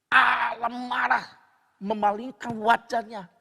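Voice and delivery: loud, drawn-out proclamation; thundering preaching. Real, not acted. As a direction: stretch each word out, high and forceful. Preacher thunders about God's anger.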